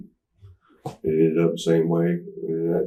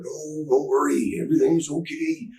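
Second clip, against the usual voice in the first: pitched-up, stretched character voice; Randy Savage; 9 semitones higher than usual. Retold or imitated. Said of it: "Randy's like".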